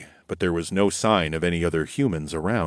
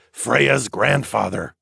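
ordinary delivery, nothing marked

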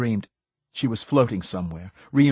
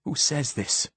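higher-pitched, curious challenge; 5 semitones higher than usual. A narrator's reading. Visitor questions Gladstone's claim, more curious than angry. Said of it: "more curiosity than anger in my voice now".

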